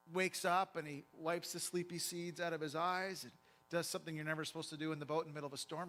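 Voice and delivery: ordinary delivery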